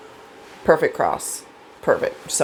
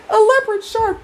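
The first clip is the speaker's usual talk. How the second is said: shocked echo. Retold or imitated. Echoing shark in alarm.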